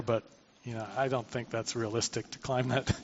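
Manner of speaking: hushed, reluctant excuse; reluctant aside. Acted. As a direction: back out quietly, voice dropping low. Voices a reluctant imagined climber.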